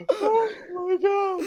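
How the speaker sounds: slow, breathless helpless laughter; laughing so hard you can't speak. Real, not acted. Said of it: "I can't stop laughing".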